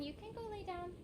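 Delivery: quiet pet talk; soft coaxing to dog. Real, not acted. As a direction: hush it as if settling a sleepy dog. Coaxing her dog to rest.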